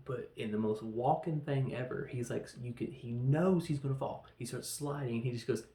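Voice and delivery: usual speaking voice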